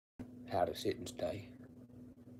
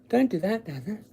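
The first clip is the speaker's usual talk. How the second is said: high, whiny plea to the dog; mock a limp telling-off; mocking soft dog owner; 12 semitones higher, 6 dB louder than usual. Mocking an owner's feeble scolding of Bazza, high and whiny. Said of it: "trying to be quiet".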